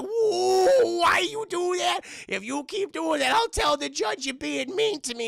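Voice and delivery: very high, loud blubbering wail; old man crying at Adam; whiny crying. Retold or imitated. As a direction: cry out like a hurt child. Whitey cries after fries thrown.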